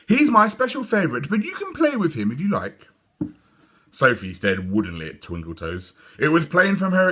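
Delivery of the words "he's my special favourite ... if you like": put-on high sweet girlish voice; high little girl showing off her toy. A narrator's reading. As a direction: play the proud little girl. Dawn voiced by narrator.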